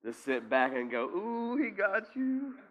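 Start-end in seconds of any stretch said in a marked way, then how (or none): 1.0-2.6 high, slow teasing cheer; mock spectator. Preacher playing a fan watching Jesus win the battle, high teasing cheer. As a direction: cheer a blow like a fan ringside.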